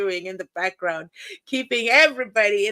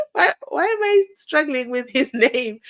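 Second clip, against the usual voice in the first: laughing; 7 semitones higher, 4 dB louder than usual. Real, not acted. Laughing at own stumbling.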